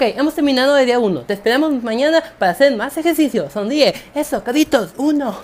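loud, upbeat workout-host voice; fitness host parody. Acted. Fake exercise host leading a challenge, loud and upbeat. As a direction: cheer on the viewers like a fitness coach.

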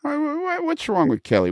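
high, whiny repeated why; mock exasperation. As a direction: play-act being baffled and indignant. Mock outrage over Kelly.

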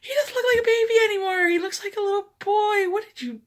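high, fast, loud near-tearful wail; upset. Retold or imitated. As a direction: lament the baby who is gone. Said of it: "And I was like".

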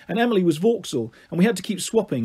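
plain reading voice

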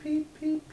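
high "peep, peep" chirped like a chick; playful high "peep, peep". Pretend. Chick sound for toddler.

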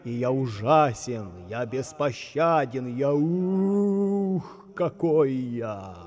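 slow, drawn-out sepulchral ghost voice; ghostly voice from beyond. Acted. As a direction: boom down at them like a ghost from above. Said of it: "всё тот же голос откуда-то сверху".

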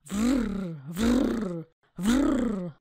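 rolling growl; dog growling. Acted. Reading the poem to kids, a rolling dog growl. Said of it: "A pies warczy".

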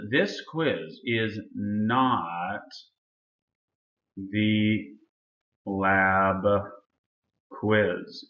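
very slow, with a long pause before each final word; deliberate, spaced-out emphasis; emphatic word by word. Real, not acted. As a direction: spell it out slowly so nobody confuses it. Stressing which quiz this is not.

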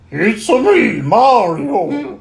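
high, loud Mario with a laugh; Mario impression. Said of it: "Big Mac doing a Mario impression".

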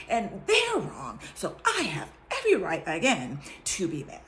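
hammed-up grievance, building; self-justifying complaint. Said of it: "and I'm like".

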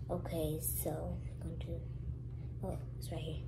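soft whisper. Real, not acted. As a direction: keep it hushed. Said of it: "whispering, hiding".

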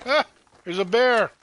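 sudden startled cry; jumping at a sudden bear. Real, not acted.

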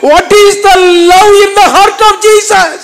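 very slow, high, loud question; impassioned preaching. Acted. Preacher asking the congregation about Jesus' love, drawn out high and loud. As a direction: stretch every word out in fervour.

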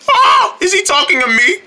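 high, startled panic; alarmed squeak. Acted. Onlooker realises he is next, high alarm. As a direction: jump in pitch with fright.

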